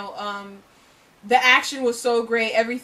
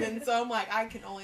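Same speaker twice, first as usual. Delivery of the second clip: usual speaking voice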